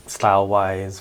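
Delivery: ordinary talk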